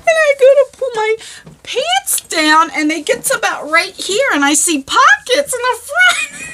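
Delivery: high, strained laughter over the words; fighting through giggles to the punchline; laughing. Real, not acted. Telling viewers the punchline, laughing hard. Reaching the punchline of her story.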